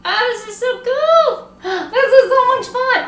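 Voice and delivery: high-pitched gushing with a gasp; thrilled. Real, not acted. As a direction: squeal it out in real delight. Thrilled at how it looks.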